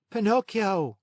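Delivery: answering up to the window; puppet boy. A narrator's reading. Pinocchio answering.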